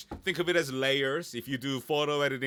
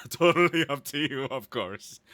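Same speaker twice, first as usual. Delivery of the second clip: laughed out louder through a grin; louder, laughing aside; 3 semitones higher, 7 dB louder than usual. Real, not acted. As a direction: let the laugh spill into the words. Laughs at her own question game.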